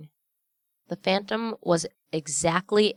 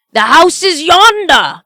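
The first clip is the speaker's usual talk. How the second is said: much higher and louder, slow and urgent; exclaiming; 12 semitones higher, 15 dB louder than usual. A narrator's reading. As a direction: Scrooge desperate, pointing at his house. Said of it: "Scrooge exclaimed".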